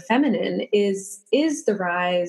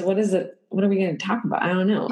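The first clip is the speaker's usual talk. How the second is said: ordinary delivery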